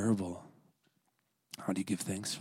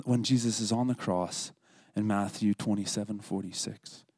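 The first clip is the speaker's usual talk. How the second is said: ordinary delivery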